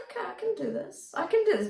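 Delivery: high, squeaky-pitched little quote; acted self-encouragement. Coach voices the student gaining confidence, high-pitched acted quote. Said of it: "And then we're like".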